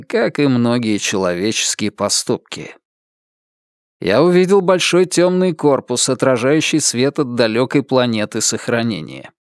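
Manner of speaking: ordinary delivery, nothing marked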